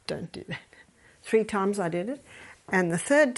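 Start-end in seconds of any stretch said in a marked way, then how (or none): 0.1-0.7 hushed, low warning; quiet reproof. Acted. Grandmother turning her head from the pinching baby, hushed reproof. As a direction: whisper a silent telling-off. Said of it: "Do you know what this says".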